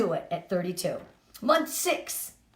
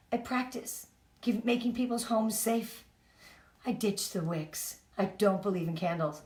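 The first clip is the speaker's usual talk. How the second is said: elevator pitch demo; 5 dB quieter than usual. Shown as a demonstration. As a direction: soften down and savour each line of the pitch. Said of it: "What are you gonna say when someone says".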